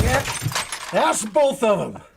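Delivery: ordinary delivery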